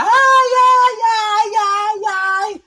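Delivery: very high, loud, sustained mock wail; gritos de dor encenados. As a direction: howl "ai" in hammed-up agony. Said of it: "ela começa a gritar". (gritando de dor, de forma exagerada)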